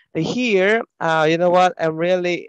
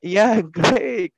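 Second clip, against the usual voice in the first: laughing with delight; happy laugh; 2 semitones higher, 3 dB louder than usual. Real, not acted. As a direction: laugh through the words. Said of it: "it sounds like you're really enjoying yourself".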